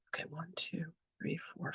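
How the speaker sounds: hushed tally, as if working it out privately; whispered counting. Retold or imitated. Woman tallying her reasons to go to Utah, whispered. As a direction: count under your breath, barely voiced.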